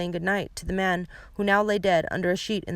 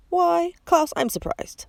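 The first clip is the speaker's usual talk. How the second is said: high, hammed-up false astonishment; mock surprise; 11 semitones higher, slower than usual. A narrator's reading. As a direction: play innocent, badly. Villain feigning surprise.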